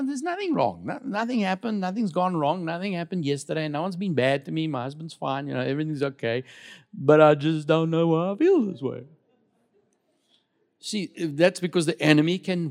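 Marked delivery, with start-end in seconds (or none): 0.0-9.0 mock wife voice. Imitating wife saying nothing's wrong.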